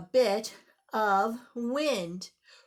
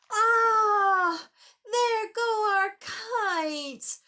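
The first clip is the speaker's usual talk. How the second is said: dismayed gasp; 9 semitones higher, 4 dB louder, much slower than usual. Acted. Kites fly away dismay.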